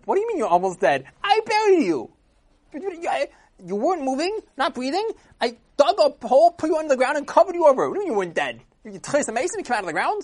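very high, loud incredulous outburst; shocked disbelief. Acted. Friend who buried him yelling in disbelief, very high and loud.

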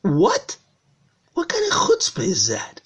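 affronted father, incredulous; indignant. Acted. Father offended by his son's text, indignant.

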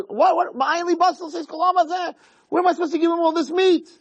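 very high, aggrieved acted rant; exasperated protest. Moshe complaining to Hashem about his burden, exasperated. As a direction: fire off indignant questions in a high pitch.

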